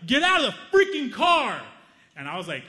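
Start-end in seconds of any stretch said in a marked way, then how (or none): 0.1-1.6 furious shouted roar, much higher and louder; angry yell. Retold or imitated. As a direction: scream it at them like a furious road-rage guy. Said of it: "He was like".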